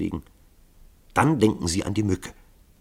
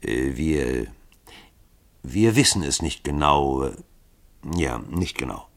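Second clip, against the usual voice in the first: tiefer, stockend und zögerlich; zögernd; 6 semitones lower than usual. Acted. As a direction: verlegen nach Worten suchen. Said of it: "begann Hawkins zögernd". (zögerlich und leiser)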